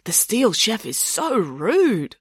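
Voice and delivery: slow, gleeful relish; excited. A narrator's reading. Boris relishing the rude TV chef, excitedly. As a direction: draw it out with delight. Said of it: "said Boris excitedly".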